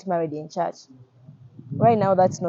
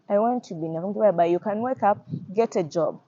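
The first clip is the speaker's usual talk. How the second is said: normal talking voice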